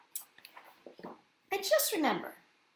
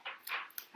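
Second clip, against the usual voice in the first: awed gasp; 14 dB quieter than usual. Acted. Al gasps at view.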